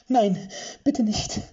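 high, loud desperate plea, slow; voice cracking. Acted. Witch begs them not to kill him, voice cracking. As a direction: beg with the voice breaking. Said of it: "Ludmillas Stimme überschlug sich".

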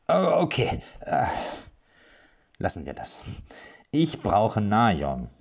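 slow, pressed, restrained anger; through gritted teeth. Acted. Erik at his wits' end, through gritted teeth. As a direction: grind it out between clenched teeth. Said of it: "knirschte er zwischen den Zähnen hervor".

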